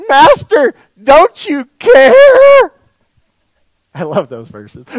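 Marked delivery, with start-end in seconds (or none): 0.1-2.7 high, loud cry with a long drawn-out CARE; panicked plea. Acted. Disciples crying out to their sleeping master in the storm, panicked plea. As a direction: wail it out in acted panic.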